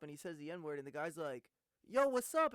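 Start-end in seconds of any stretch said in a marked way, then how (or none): 1.9-2.5 a much higher, louder yo what's up; hyped-up street greeting; loud excited greeting. Retold or imitated. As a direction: call it out like greeting a friend. Retold reply in viral video.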